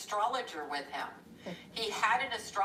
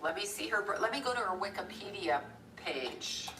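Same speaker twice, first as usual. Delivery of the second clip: usual speaking voice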